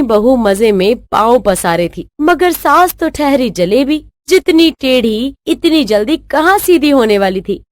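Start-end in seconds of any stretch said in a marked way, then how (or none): none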